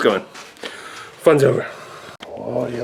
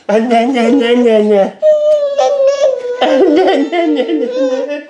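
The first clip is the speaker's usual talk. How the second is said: high, loud nasal-syllable chant; mock a fussy baby to get giggles; playful high "nah-nah" imitation; mimicking baby fussing; 12 semitones higher, 5 dB louder, slower than usual. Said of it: "your brother and the nah, nah, nah".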